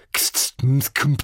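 crisp clipped syllables; staccato. A narrator's reading. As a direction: snap out each sound sharply. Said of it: "sagte zackig".

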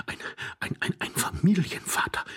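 panicked stammer, whispered. Acted. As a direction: stumble over the words.

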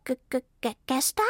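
squirrel guesses, unsure; a stuttering squirrel guessing; hesitant stammer. Acted. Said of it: "meinte es zögernd".

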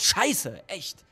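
usual speaking voice

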